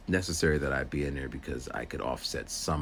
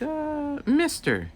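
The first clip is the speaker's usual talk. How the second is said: put-on much higher, unsure little girl's voice; hesitant student voice; much slower than usual. Student guessing the aide's name, in a high hesitant girl's voice.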